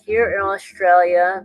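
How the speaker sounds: ordinary talk, nothing marked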